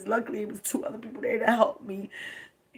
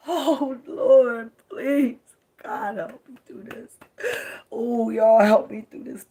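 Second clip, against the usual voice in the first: breaking into sobs, pleading louder to God; real crying; 3 semitones higher, 10 dB louder, slower than usual. Real, not acted. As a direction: cry it out, begging for help through the tears. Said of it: "getting on here crying".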